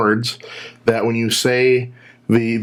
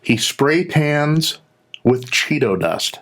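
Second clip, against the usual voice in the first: slow deliberate; 4 semitones higher, slower than usual. Real, not acted. Punchline of conspiracy joke.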